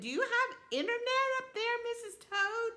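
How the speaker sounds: high, incredulous question; can't believe it, ask it way up high; grandma amazed the toad is online. Acted. Nana amazed toad has internet.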